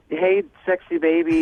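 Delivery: put-on high breathy baby talk.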